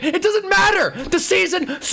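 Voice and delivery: normal talking voice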